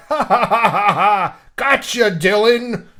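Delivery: high, loud hammed-up cackle; mock fit of laughter. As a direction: ham up a gloating prank laugh. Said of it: "starts laughing in this fit of laughter".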